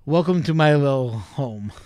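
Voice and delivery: sinister distorted welcome; creepy host voice. Acted. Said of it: "that creepy voice of mine".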